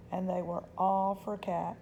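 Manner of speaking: plain reading voice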